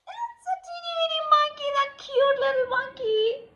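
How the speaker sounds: put-on high, quick teasing baby talk; mock cutesy voice. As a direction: coo it mockingly at the little monkey. Monster mocks the cute monkey.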